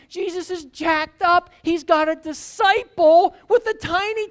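impassioned sermon delivery; fervent preaching. Acted. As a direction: drive the point home to the congregation. Preaching at full force.

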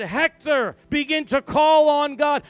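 loud, high commanding call; ringing preacher's command; urgent call. Retold or imitated. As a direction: call him to God with force. Commanding possessed man to pray.

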